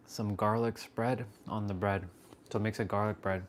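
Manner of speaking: normal talking voice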